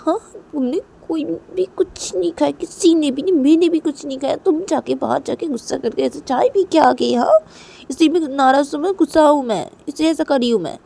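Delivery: high, indignant complaint; acted upset, angry wife. A narrator's reading. Wife says she is angry, upset. (नाराज़ पत्नी की ऊँची शिकायत)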